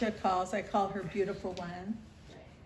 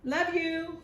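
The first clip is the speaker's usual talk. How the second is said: yelling good night; 10 semitones higher, 8 dB louder, slower than usual. Retold or imitated. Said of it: "We'd yell real loud".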